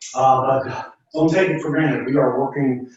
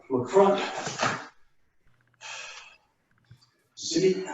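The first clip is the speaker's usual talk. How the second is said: sharp martial arts commands; 3 semitones higher, slower than usual. Acted. Instructor calling kata commands.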